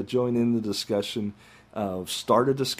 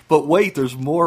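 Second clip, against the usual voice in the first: infomercial; 5 semitones higher, 7 dB louder than usual. Pretend. Mock infomercial pitch.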